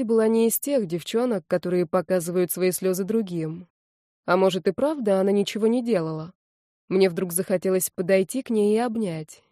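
usual speaking voice

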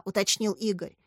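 ordinary delivery

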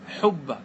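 حبة said "hubba", with u after the h; damma vowel. Shown as a demonstration. Teacher showing students a verb form, حبة with damma, "hubba". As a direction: put a short u in حبة: "hubba". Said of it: "حبة بالضم".

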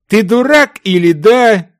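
loud, high incredulous scorn; exasperated jeer. A narrator's reading. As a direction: challenge him as a complete fool. Partner mocks the treasure hunter. (возмущённая издёвка)

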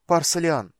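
sharp high strangled call; strained call. A narrator's reading. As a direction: call out, voice tight. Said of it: "позвала Ладонна сдавленным голосом".

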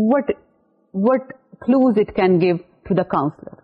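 ordinary delivery

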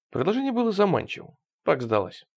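usual speaking voice

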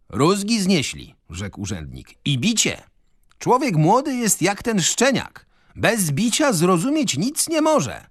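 wysoki, mentorski głos starego urzędnika; pompatyczny urzędnik. Acted. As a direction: graj zadowolonego z siebie moralizatora. Urzędnik speaks again. (urzędnik poucza przy kolacji, wysoko i mentorsko)

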